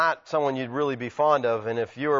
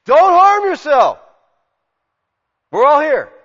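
long, high calling shout; urgent shout to stop a man; loud cry; 12 semitones higher, 12 dB louder, slower than usual. Acted. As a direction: cry out across the dark prison. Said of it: "he hears this cry".

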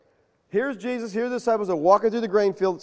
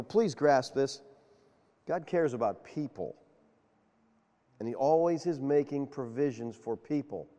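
sincere plea; 5 semitones lower, 8 dB quieter than usual. Real, not acted. Preacher urging his congregation to grasp God's care, softly sincere. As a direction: lean in and plead gently.

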